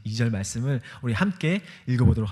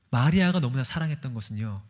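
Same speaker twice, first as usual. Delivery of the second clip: usual speaking voice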